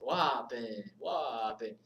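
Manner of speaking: "Wapin" drawn out with a falling Jamaican lilt; amateur Jamaican-accented "Wapin"; attempted Jamaican accent. Shown as a demonstration. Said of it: "can't say it in a Jamaican accent".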